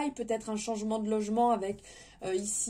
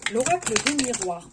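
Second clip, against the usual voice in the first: about to sneeze; words caught by a sneeze; sneezing; 5 dB louder than usual. Real, not acted. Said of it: "j'éternue en même temps".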